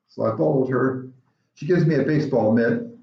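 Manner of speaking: normal talking voice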